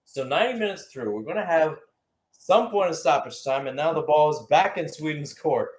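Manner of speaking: normal talking voice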